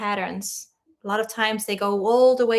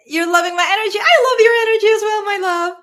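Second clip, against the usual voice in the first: gushing; 8 semitones higher, 8 dB louder, much faster than usual. Real, not acted. Gushing back at a viewer.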